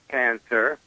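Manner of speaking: normal talking voice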